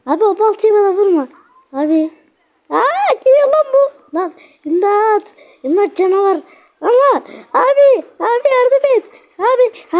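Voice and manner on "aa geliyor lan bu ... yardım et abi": high, frantic calls for help; panicked plea. Real, not acted. Player chased by the axe monster, frantic high plea. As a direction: beg your brother to save you.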